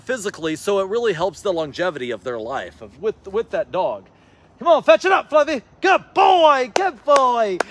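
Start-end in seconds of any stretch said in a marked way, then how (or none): 4.6-7.6 high, loud, gushing praise for the dog; excited praise. Shown as a demonstration. Trainer showing owners how to praise, high and excited. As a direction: sound thrilled so the dog feels it. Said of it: "You know, be excited".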